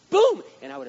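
a sudden loud high burst; mock shout. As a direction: shout it like a win. Imagined beating the buyer.

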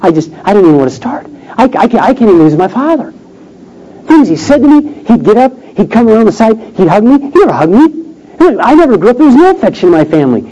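high, overwhelmed voice imitating a crying wife; acting out tearful disbelief, words tumbling; retold tearful outpouring. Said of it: "She starts crying".

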